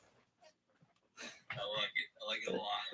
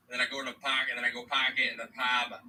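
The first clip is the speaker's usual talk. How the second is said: Boston accent with broad, R-less vowels. Shown as a demonstration. Adding his own line to show off his Boston accent. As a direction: drop every R like a Bostonian. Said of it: "say in my Boston accent".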